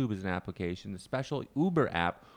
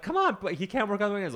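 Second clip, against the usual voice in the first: pleading job coach; 10 semitones higher, faster than usual. Retold or imitated. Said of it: "the job coach was like".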